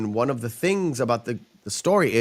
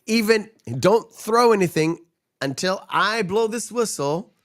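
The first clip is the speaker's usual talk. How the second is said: role quote; 9 semitones higher than usual. Retold or imitated. Quoting official at the stoning.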